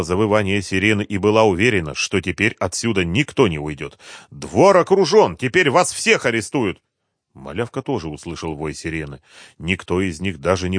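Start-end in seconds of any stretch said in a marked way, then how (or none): 4.3-6.8 slow, loud, high proclamation; defiant warning. A narrator's reading. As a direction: announce their defeat loudly. Defiant woman threatens the gang.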